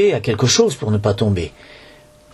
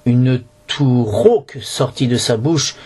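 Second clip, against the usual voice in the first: usual speaking voice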